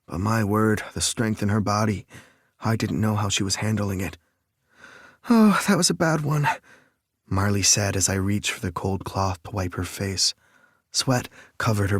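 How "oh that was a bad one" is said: strained high voice after a contraction; breathless, hurting; pained gasp. Acted. Labor pain, contraction.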